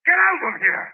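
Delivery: rising to a loud furious order to leave; angry. Acted. Tenant ordering the nosy policeman out, furious. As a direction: throw him out of the house.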